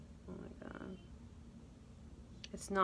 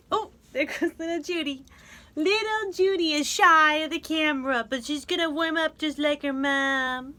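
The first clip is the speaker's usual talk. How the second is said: put-on cooing baby talk; 7 semitones higher, 8 dB louder than usual.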